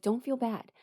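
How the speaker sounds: ordinary delivery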